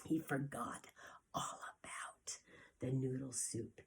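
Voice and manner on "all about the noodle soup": whisper. Acted. Suspense as dragon finds baby.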